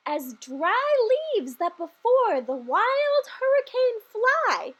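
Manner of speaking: slow, measured verse reading; poem recital. Acted. Reading the Christmas poem to listeners, measured recital. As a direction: lilt through the rhyme like a bedtime poem.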